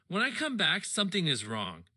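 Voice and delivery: ordinary delivery, nothing marked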